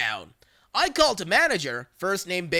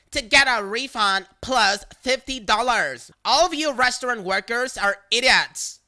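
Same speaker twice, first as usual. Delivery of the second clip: louder, contemptuous; insulting caller; 5 semitones higher, 5 dB louder than usual. Retold or imitated. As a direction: spit it out at the staff.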